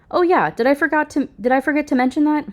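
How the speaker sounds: high, fast, fake-innocent question; feigned surprise at her own oversight; mock innocent. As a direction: play dumb, sweetly mocking. Daughter taunts mother about kids.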